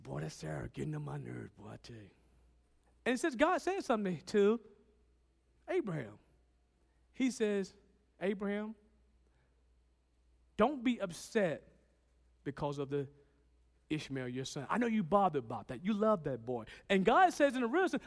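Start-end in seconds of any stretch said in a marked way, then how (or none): 0.0-2.1 much softer, lower, a tired husband muttering; weary grumble. Retold or imitated. Abraham complains to God. 8.2-16.6 soft, low, reassuring; calm a troubled father; comforting God. Retold or imitated.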